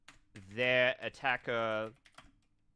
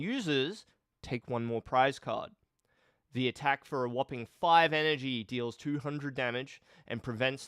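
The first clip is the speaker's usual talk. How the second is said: punchy, louder presenter read; scripted video review; 2 semitones higher, 6 dB louder than usual. Acted. Streamer recording a card review for shorts, punchy presenter read. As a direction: open big, then drive briskly through the card review.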